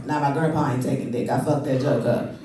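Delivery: ordinary talk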